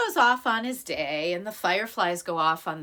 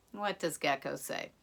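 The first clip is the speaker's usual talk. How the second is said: ordinary delivery, nothing marked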